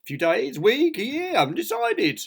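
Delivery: high, breezy sing-out of options; grinning. A narrator's reading. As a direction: toss them out without a care. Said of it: "grinned Mister Clumsy".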